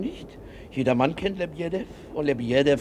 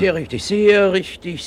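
higher, louder and slow, fawning; eager agreement; 7 semitones higher, 7 dB louder, slower than usual. Acted. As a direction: lean in and agree with relish. Busybody eagerly butts in. (eifrig zustimmend, lauter und höher, langsam)

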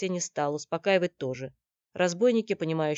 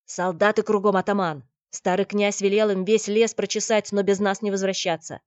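loud, raised urgent warning; urgent report; 4 semitones higher, 10 dB louder than usual. Acted. Никита warns the chieftain of soldiers, loud and urgent. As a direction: blurt the alarm out loudly.

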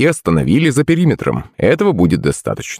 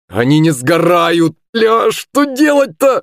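very high, drawn-out cry; stretched, high-pitched alarm; panicked shout; 10 semitones higher, slower than usual. A narrator's reading. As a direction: wail it slowly in mounting panic. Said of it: "закричал Илья".

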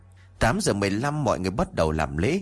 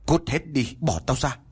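cursing; 6 semitones higher than usual. A narrator's reading. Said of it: "miệng vẫn chửi rủa".

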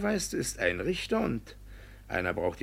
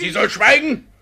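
angry shout; 12 semitones higher, 11 dB louder than usual. Acted. Judge orders the interrupting woman to be silent, shouting.

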